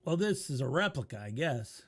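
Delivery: normal talking voice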